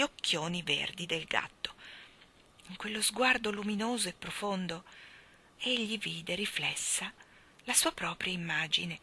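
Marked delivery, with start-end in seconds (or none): none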